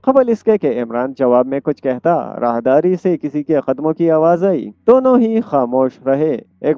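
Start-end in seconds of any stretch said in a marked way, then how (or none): none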